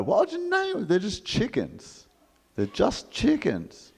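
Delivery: exaggerated high mock-mouthing, slow on They're just chickens; mouthed disbelief. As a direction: overact a silent baffled question. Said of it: "I mouthed this to her".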